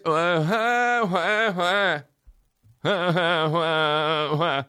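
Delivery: high, drawn-out fake bawling; mock crybaby wail. Mocking liberals as whining crybabies.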